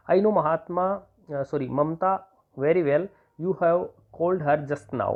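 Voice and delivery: slow, spelled-out dictation; measured dictating pace. Shown as a demonstration. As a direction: read it out slowly for notes. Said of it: "teacher dictating example sentence".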